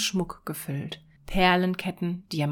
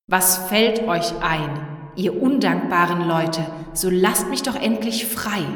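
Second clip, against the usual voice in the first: trembling with rage, yell at them; angry cry; 5 dB louder than usual. Acted. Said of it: "rief verärgert". (verärgert rufend)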